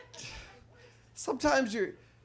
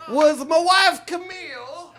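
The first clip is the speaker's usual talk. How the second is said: Bill Cosby impression; 7 semitones higher, 7 dB louder than usual. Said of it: "my Bill Cosby impression".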